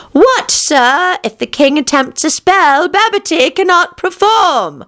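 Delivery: put-on high-pitched old witch, politely asking; questioning old washerwoman. A narrator's reading. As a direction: voice an old woman posing a query.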